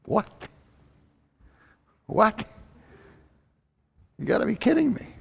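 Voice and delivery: high, hushed incredulous what; disbelief. Acted. Jailer hearing Paul, stunned and disbelieving.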